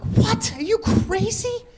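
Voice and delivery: high, incredulous what; shocked disbelief. Retold or imitated. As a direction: gasp it out in disbelief. Shocked reply to in-laws.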